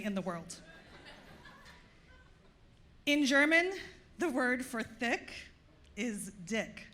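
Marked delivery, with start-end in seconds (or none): none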